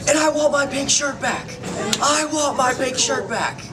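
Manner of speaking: high, indignant movie-quote shriek; flamboyant friend. Retold or imitated. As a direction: imitate a flamboyant guy demanding it back. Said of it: "the flamboyant friend of hers goes".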